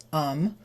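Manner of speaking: THEM reduced to a bare "um". Shown as a demonstration. Teacher shows learners the reduced form UM for THEM. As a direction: say UM for THEM, dropping the TH. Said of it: "we learned that "them" becomes".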